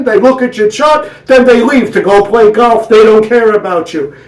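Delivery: high, loud, whiny rant; kvetching wife. Acted. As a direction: build into an outraged rant.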